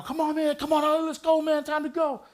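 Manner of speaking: high calls urging him out; retold shouting from the doorway; calling out.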